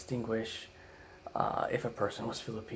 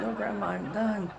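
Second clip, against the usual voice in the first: laughing reply; 9 semitones higher than usual. Retold or imitated. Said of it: "I said".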